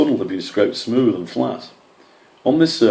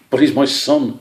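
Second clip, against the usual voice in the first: protesting; 5 semitones higher, 5 dB louder than usual. A narrator's reading. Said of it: "Earthface protested".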